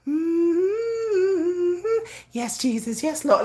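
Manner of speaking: sing-song it like a church mother; mock churchy praise. Slow, high churchy praise, mocking her calm self. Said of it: "She up here talking about".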